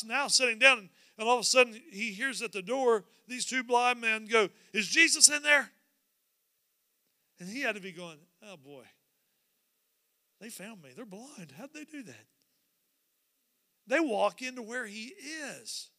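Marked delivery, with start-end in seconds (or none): 4.7-5.7 louder, raised call at a door. Acted. Blind men at the door looking for Jesus, calling out. 8.4-12.3 much softer, under the breath; dismayed aside. Acted. Jesus realizing the blind men found him, muttered aside.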